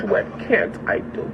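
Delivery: breathy slow whispered gloat. Real, not acted.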